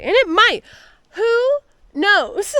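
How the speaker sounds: bright, high, hopeful lift. Real, not acted. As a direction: toss it off hopefully. Hopeful about the weather.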